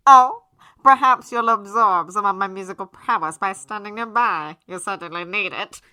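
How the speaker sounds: loud, pompous, theatrical aristocrat; haughty gentleman voice. A narrator's reading. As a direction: sneer it grandly like a snob. Alistair voiced, loud, far.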